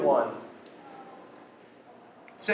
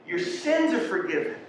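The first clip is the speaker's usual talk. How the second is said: answering from above; 9 semitones higher than usual. Acted. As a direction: lift the pitch high for the answer. Acted divine answer to his yelling.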